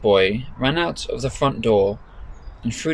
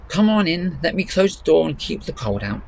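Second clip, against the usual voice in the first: higher granny voice, hospitable; welcoming gran; 7 semitones higher than usual. Acted. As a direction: grandmother ushering family in from the cold. Gran's voice resumes.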